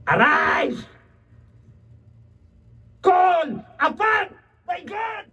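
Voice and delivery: high, slow shouted command. Retold or imitated. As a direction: order him up with full force. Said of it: "ang sabi ng shipmaster kay Jonah".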